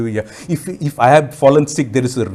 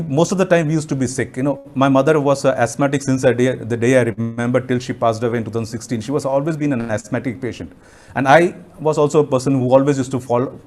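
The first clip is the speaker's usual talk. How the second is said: usual speaking voice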